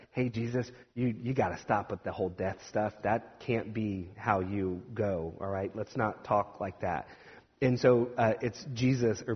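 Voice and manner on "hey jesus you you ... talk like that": softer, pulled-aside plea, slowing down; hushed aside. Retold or imitated. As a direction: quietly urge him, as if taking him aside. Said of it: "he pulls Jesus aside".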